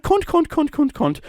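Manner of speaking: high, repetitive mock-Irish chant; hammed-up Irish voice, pitched very high; Irish accent. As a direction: rattle the word out in a put-on Irish accent. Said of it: "talk like this".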